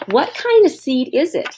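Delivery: high, curious little pig asking; eager child voice. Acted. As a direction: ask it like an eager little boy. Oliver asks eagerly about seed.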